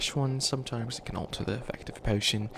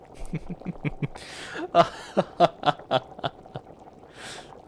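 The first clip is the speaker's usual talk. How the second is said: high, breathy practiced villain cackle; evil laugh attempt; 7 semitones higher than usual. Witch showing off her practiced evil laugh, cackling. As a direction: try out a new evil laugh. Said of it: "I'm trying to work on my evil laugh".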